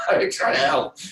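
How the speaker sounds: half-laughing mock sob; mock wail. Playing a frustrated helper, hammed-up crying.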